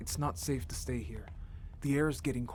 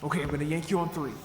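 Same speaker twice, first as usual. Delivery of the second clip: loud, quick count before the heave; effortful count; 3 semitones higher, 7 dB louder than usual. Acted. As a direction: count off and yank hard. Yanking partner through.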